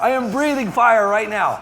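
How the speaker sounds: loud, high, half-laughing outcry; alarmed exclamation. Real, not acted. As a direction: exclaim through the burn. Overwhelmed by hot sauce burn.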